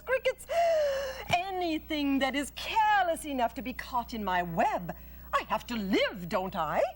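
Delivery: matter-of-fact spider relishing her menu; Charlotte the spider. Acted. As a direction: list her favourite bugs with glee.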